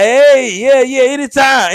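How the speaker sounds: put-on old man smooth talk. Imitating the old man answering the wife, smooth. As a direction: toss it off like a smooth old charmer. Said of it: "And he was like".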